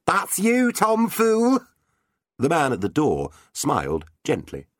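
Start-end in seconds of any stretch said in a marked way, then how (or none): 0.1-1.6 gentle knight teasing. Acted. Knight teases the fool back.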